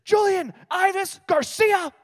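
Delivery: high, loud, slow drawn-out full-name call; mom yelling full name. Retold or imitated. Mom calling her son across the lunchroom, loud slow yell. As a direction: bellow his full name like an angry mom. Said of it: "I hear this".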